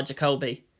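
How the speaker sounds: usual speaking voice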